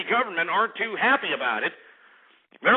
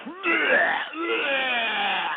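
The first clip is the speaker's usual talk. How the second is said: disgusted bleh; 8 semitones higher than usual. Retold or imitated. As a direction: spit it out like a kid tasting beer. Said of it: "I was like".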